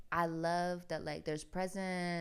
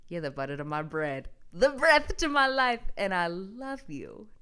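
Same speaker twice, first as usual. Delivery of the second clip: sappy; 2 semitones higher, 5 dB louder than usual. Pretend. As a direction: croon it like a cheesy lover. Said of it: "I'll stop being sappy".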